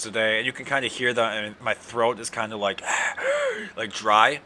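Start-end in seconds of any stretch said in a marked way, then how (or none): none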